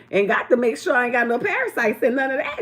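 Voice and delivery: usual speaking voice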